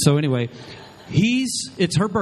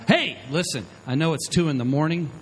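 ordinary talk, nothing marked

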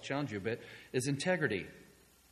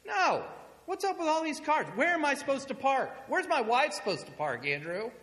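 high, loud, exasperated yelling; angry neighbor yelling; 8 dB louder than usual. Retold or imitated. Neighbor refuses to step outside and rants about parking, yelling angrily. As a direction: shout each question in outrage.

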